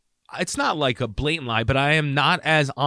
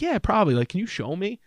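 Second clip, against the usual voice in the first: high, eager mock-humble reply; eager coachable patient; 8 semitones higher than usual. Patient charming the hygienist, high eager reply. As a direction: play the keen pupil up high. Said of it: "I'm like".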